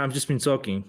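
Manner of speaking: usual speaking voice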